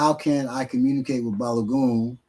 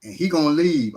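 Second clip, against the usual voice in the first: ordinary talk, nothing marked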